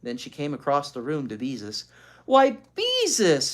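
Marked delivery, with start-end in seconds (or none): 2.3-3.5 surprised. A narrator's reading. Teacher surprised by painting.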